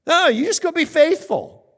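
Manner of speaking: high, loud, insistent correction; firm overruling. Acted. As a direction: cut off the excuse emphatically. God overrules the excuse.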